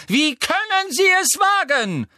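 piercingly high, loud outcry; outraged shout. A narrator's reading. Professor running at the attackers, shouting in outrage. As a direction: shout it in sheer indignation. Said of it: "rief die Gestalt im Laufen".

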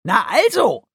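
high, loud creature exclamation; triumphant high squeak; satisfied creature. A narrator's reading. Guki satisfied with louder answer.

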